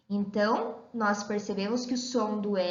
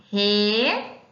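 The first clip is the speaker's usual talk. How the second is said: "re" held long and louder by itself; lone, emphatic "re"; isolated syllable; 2 semitones lower, 6 dB louder than usual. Shown as a demonstration. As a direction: stretch "re" out firmly as you write it. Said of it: "a prof vai escrever aqui".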